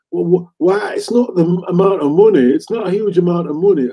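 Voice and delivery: indignant FA official moaning about the money; mock FA official accent. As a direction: ham up a stuffy FA official whining about a fine. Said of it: "So I have to say it in that accent".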